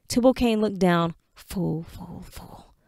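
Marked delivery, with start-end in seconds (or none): 1.3-2.7 hushed self-scolding murmur; muttered self-reproach. Acted. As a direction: whisper it to yourself, bitter. Self-reproach under breath.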